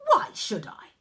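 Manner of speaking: loud, sharp challenge; defiant retort. A narrator's reading. Arachne defying the old woman, loud. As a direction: throw the question back at her.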